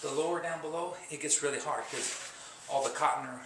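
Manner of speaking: ordinary talk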